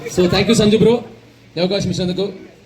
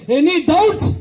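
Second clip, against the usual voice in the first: cocky challenge; 5 semitones higher, much slower than usual. Acted. Punchline after the rant.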